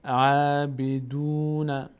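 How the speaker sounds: عبدون very slow with the long u stretched; slow recited عبدون; long vowel drawn. Shown as a demonstration.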